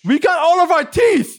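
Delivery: very high, drawn-out imitation; hammed-up high-pitched retort; mock indignant protest. Mimics friend's retort to cops.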